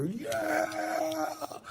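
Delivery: high whooping cheer; crowd cheering. Retold or imitated. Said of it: "They go".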